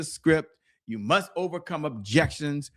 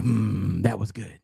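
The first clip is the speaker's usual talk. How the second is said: put-on old mentor; 9 semitones lower, slower than usual. Elderly mentor praising a young speaker after his talk, lowered voice. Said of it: "And he was like".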